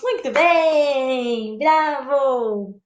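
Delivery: long-drawn cry of bravo; slow, admiring acclaim; applauding. Shown as a demonstration. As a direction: cheer a performer, stretching each phrase.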